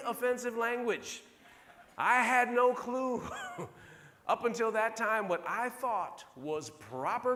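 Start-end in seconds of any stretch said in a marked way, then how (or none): 3.3-4.2 breathy, high laugh without voice; airy laugh. Real, not acted. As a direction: let out a silent, breathy laugh. Laughing after admitting ignorance.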